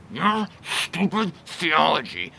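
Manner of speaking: "your stupid theology" slow and sneering; scornful. Shown as a demonstration. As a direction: drag out the contempt. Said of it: "notice the difference between".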